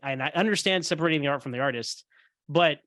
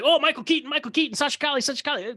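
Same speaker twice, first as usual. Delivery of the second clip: mocking hype; 9 semitones higher, faster than usual. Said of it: "It's like".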